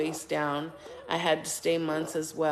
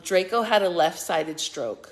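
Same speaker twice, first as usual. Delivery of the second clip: ordinary talk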